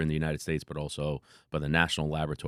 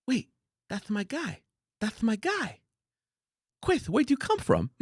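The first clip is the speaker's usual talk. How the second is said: high, soft mock Tyson voice; lightly lisping high boxer voice; surprised high-voiced Tyson imitation; Mike Tyson impression; 3 dB quieter than usual. Tyson quoted.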